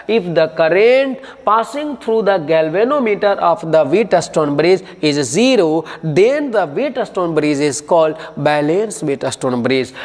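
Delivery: slow, measured dictating pace; slow dictation. Shown as a demonstration. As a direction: read the definition out for notes. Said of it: "dictating definition".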